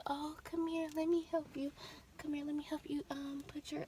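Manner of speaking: hushed high mock-coddling mom; imitating mom babying her, soft and high; mom fussing softly. Imitating mom babying her, hushed and high. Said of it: "my mom is like".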